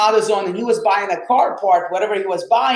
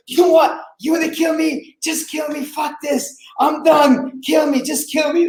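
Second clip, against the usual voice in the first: desperate high shouting, near breaking; retold yelling; 8 semitones higher than usual. Yelling at the driver with the gun, high desperate shouting. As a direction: yell it out like you've given up on living. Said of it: "people would hear me yelling".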